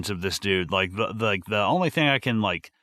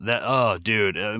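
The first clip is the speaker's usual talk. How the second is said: normal talking voice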